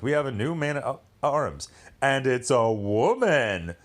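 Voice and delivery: hammed-up announcement, swelling on the last words; mock royal proclamation. King announcing a promotion to the kingdom, mock proclamation. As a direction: drag out the big reveal like a mock herald.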